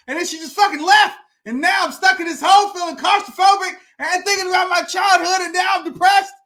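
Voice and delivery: high-pitched complaint building to a shout; aggrieved rant. Retold or imitated. Man stuck in hole to doctor, exasperated rant building.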